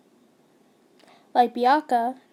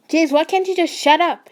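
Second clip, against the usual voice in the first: loud, high, furious shout; angry yell; 5 semitones higher, 7 dB louder than usual. A narrator's reading. Boyfriend yelling at the listener to shut up. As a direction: snap at her to shut up. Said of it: "he yelled".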